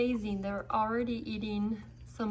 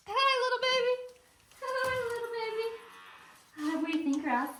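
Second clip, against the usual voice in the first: cooing pet talk; 10 semitones higher, faster than usual. Real, not acted. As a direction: coo at the babies and the dog. Coos at porcupines and dog.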